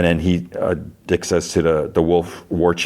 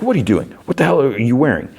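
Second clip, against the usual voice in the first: raised-pitch incredulous demand; mock indignation; 7 semitones higher, faster than usual. Husband finding his wife in clown costume, mock indignant. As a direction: challenge her, appalled, in a higher voice.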